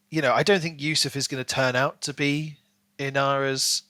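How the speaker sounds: ordinary delivery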